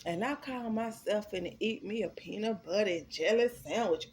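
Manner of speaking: normal talking voice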